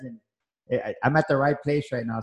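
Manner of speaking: ordinary delivery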